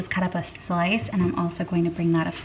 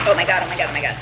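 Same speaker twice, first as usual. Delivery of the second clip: startled; 2 semitones lower, much faster than usual. Real, not acted. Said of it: "I'm always afraid I'm gonna burn myself".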